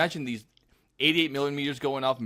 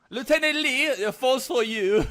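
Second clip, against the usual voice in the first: high, drawn-out call; French phone answerer; 11 semitones higher, 4 dB louder, slower than usual. Acted. Frenchman calling the lieutenant to the phone, high hammed-up shout. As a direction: summon someone to the phone comically.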